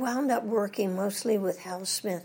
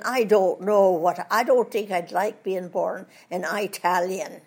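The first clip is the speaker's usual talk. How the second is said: put-on Irish accent; 6 dB louder than usual. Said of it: "and one is saying".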